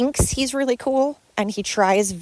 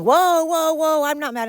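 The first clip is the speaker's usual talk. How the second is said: mocking the partner; 7 semitones higher than usual. Quoting the partner back at him, mock alarm. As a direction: parrot his overdone whoas in a raised voice. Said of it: "why are you going".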